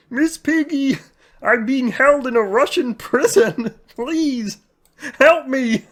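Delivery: high, slow frog puppet voice lecturing; Kermit impression. Kermit pleading with Miss Piggy, high frog voice. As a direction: Kermit preaching self-help to Piggy. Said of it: "my Kermit impression".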